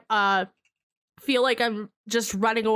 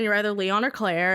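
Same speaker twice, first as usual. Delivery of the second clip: ordinary delivery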